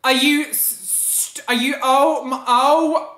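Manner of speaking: high, loud, broken-off stammering; speechless outrage. Real, not acted. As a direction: stammer in outrage, words failing you.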